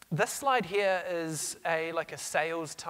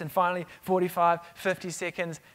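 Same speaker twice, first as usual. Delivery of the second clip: ordinary talk, nothing marked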